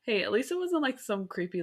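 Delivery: usual speaking voice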